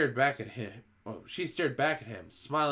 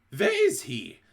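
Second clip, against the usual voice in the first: louder, sharp witch question; a touch of impatience; mild snap; 7 dB louder, slower than usual. A narrator's reading. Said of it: "snapped the Grand High Witch".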